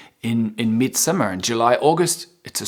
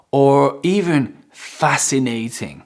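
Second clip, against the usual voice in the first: ordinary talk, nothing marked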